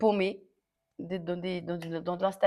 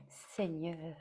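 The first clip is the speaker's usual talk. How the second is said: whispered aside; 3 semitones lower, 9 dB quieter than usual. Real, not acted. Exasperated aside.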